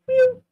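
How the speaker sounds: high, loud imitated meow; cat meow. Cat greeting her with a loud meow. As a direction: mimic the cat's meow.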